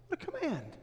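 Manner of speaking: ordinary talk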